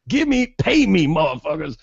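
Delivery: jokey impatient demand for cash; mock greedy demand. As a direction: put on a pushy, pay-up voice. Voices the woman wanting money.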